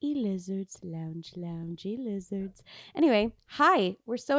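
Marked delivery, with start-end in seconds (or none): 0.0-2.6 robot voice. Pretend. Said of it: "I will sound like a robot".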